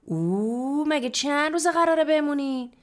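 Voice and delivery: long high "اوه" of amazement, then loud question; astonished. A narrator's reading. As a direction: exclaim in exaggerated surprise. Sister amazed at guest's luggage.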